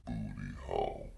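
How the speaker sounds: very low slowed-down voice; deep distorted echo. Retold or imitated. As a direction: drop it deep like a slowed recording.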